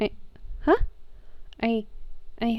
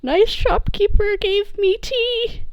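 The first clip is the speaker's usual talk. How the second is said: much higher, happy in-character voice; delighted childlike character; 14 semitones higher than usual. Acted. Player voices Sammy thrilled with the tea, high and delighted. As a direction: gush in a high, simple voice.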